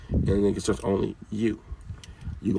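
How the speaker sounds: usual speaking voice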